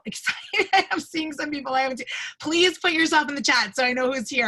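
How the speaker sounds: high, delighted welcome breaking out; excited. Real, not acted. Host spotting old congregants joining the call, excited. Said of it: "I'm so excited to see you".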